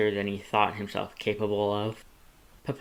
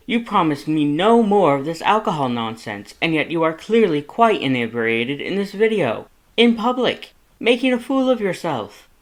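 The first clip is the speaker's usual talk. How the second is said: building from loud to much higher and louder; each accusation climbs higher; rising scolding; 7 semitones higher, 7 dB louder than usual. A narrator's reading. Papyrus scolds his drunk brother, voice climbing to a shriek. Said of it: "Papyrus's voice rose with every denouncement".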